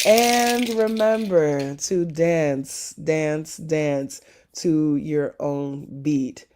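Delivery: slow, drawn-out reminder. Real, not acted. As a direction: stretch each word.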